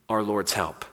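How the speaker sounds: normal talking voice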